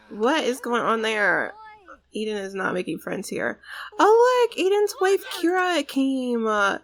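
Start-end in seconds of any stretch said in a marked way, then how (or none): 4.0-6.5 much higher, louder excited squeal. Real, not acted. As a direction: gush over the new arrival. Excited at Kira arriving.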